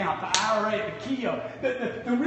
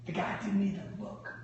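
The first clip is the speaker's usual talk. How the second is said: usual speaking voice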